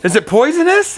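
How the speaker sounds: high-pitched imitated exasperation; mildly exasperated retold question; exasperated quote. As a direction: ask it high, as a rattled character would. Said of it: "exasperated about what happened".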